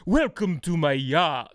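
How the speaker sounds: slow, put-on Italian accent for the villain; fake Italian accent. Movie villain welcoming guests aboard, in a slow mock Italian accent. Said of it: "talking like Italian or an accent".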